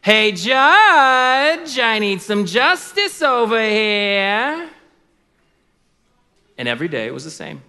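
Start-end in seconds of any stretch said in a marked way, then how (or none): 0.0-4.7 loud, high, drawn-out holler; widow hollering for justice. Acted. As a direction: call out brashly, stretching the last word. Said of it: "She would threaten the court clerk and then say".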